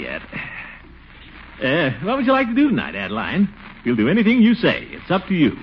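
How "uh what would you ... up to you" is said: high, eager, hurried wooing; a nervous suitor trying too hard; eager lovestruck pitch. Acted. Eager suitor pressing Adeline.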